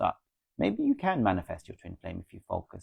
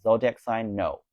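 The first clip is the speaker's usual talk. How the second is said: usual speaking voice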